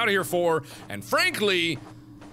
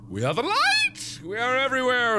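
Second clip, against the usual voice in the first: much higher, slowed grand spirit voice; mock grand proclamation; 13 semitones higher, 4 dB louder than usual. Fairies boasting to Fran, in a hammed-up grand voice. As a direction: declare it like a pompous spirit.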